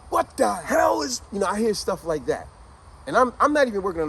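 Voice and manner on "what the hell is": slow, high-pitched retold shout; father yelling. Father yelling inside the house, slow imitated shout. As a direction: stretch it out like an outraged dad. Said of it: "I hear the father's voice yelling".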